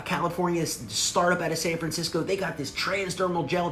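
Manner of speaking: usual speaking voice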